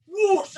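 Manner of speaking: high, loud imitated jet whoosh; whoosh sound. Telling of the self-cleaning toilet, loud whoosh. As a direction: blast out a water-jet whoosh.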